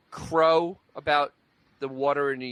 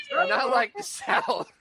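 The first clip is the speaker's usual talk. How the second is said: mock Southern accent; 7 semitones higher, much slower than usual. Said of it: "That's how Minnesota talks".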